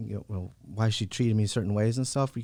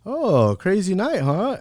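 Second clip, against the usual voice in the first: a light nurse voice, much higher and louder; imitating the nurse; 10 semitones higher, 8 dB louder, much slower than usual. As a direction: tease like a knowing nurse. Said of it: "the nurse is like".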